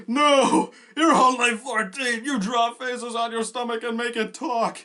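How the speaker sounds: high, loud outraged shout; frantic protest. Retold or imitated. Protesting a fourteen-year-old's marriage in the show, frantic high shout. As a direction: yell it in shrill, over-the-top alarm.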